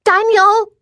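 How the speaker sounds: put-on high-pitched child's yell; piercing little-girl call; little girl's yell. A narrator's reading. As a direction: yell for your big brother. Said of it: "she yelled".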